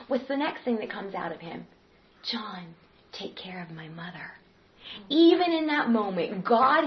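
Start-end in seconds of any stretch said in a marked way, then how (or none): 2.2-4.3 faint whisper, almost no voice; hushed words from the cross; dying whisper. Acted. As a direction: breathe it out like a dying man.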